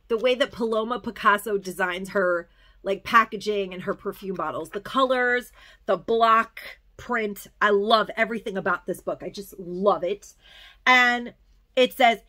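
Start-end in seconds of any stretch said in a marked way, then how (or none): none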